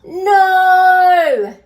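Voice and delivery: high, loud long howl; crying out no. A narrator's reading. Bob the dog cries out over his toys. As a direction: wail it at full volume. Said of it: "cried Bob".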